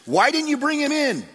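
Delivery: high, loud indignant demand. Acted. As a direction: bark the question at the guards.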